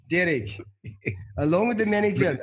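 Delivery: hammed-up South African accent. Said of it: "Use a South African accent".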